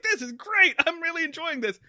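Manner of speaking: high-pitched retold enthusiasm; hyped. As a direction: re-enact being thrilled, pitch jumping up. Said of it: "I got real hype about it".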